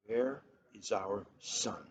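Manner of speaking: soft, slow, hushed question; whispered. Acted. Mother asking the father about their lost son, hushed and slow. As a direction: let it fall to a near whisper. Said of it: "Moon Sun whispered".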